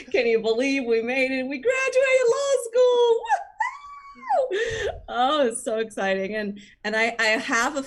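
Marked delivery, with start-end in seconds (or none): 1.5-4.9 high, giddy celebration rising into a whoop; excited cheering. Acted. New graduate celebrating law school to classmates, excited cheering. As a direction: cheer it out high-pitched, ending on a woo-hoo.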